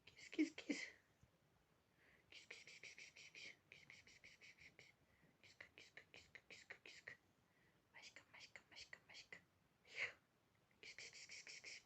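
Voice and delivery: faint whispered kis-kis; whispered kitty calling. Real, not acted. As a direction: call the cat in a whisper.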